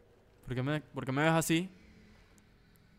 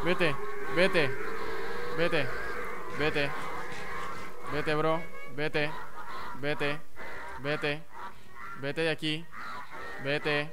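scared pleading; 4 dB louder, much slower than usual. Real, not acted. Gamer pleading with a monster to leave, scared pleading. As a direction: shoo it away, calming as it goes.

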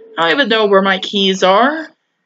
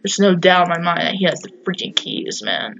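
ordinary talk

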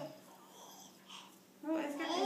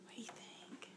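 whisper; 3 semitones higher, 16 dB quieter than usual. Real, not acted. Whispering to the baby.

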